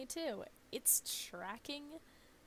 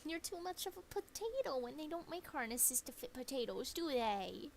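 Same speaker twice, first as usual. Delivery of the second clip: higher cooing pet voice; doggy baby talk; 5 semitones higher than usual. Acted. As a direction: tease the chubby dog lovingly.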